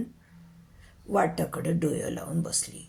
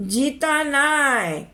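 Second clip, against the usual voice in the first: high, drawn-out pronouncement; loud declaration; 7 semitones higher, 3 dB louder, much slower than usual. Acted. Novice doctor announcing his verdict to the crowd, loud declaration. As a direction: proclaim it slowly with a falling end. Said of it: "मोठ्या आवाजात ठोकून दिलं".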